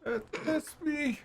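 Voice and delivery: high, pleading captive voice; high prisoner voice. Acted. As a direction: beg to be let out of the cage. DM voices caged prisoner Carl.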